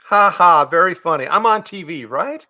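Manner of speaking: put-on scoffing boy. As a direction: scoff like a kid who smells a prank. James scoffs.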